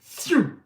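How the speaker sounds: high, loud imitated whoosh; sudden "shoo" sound effect; whooshing launch noise. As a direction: imitate a dart flying off fast. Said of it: "and just go".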